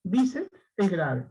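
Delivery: usual speaking voice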